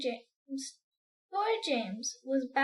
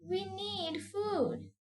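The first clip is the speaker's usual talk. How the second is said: centipede; 6 semitones higher, 3 dB quieter, much slower than usual. Acted. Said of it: "he said".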